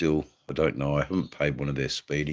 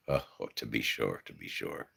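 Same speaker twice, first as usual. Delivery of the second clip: hammed-up stage Irish, lower and softer; over the top irish accent; 9 semitones lower, 10 dB quieter than usual. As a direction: lay on a thick Irish brogue. Irish phrase to a Mister Murphy.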